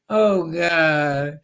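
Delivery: ordinary talk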